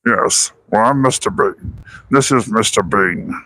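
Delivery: lightly done Mister Bean, a touch slow; a hint of a Mister Bean mumble. Answering his friend's question with a light Mister Bean voice. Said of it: "That's my best Mister Bean impression".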